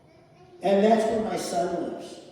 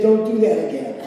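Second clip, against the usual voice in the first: laughing mock warning; 9 dB louder than usual. Dad's reply, told laughing.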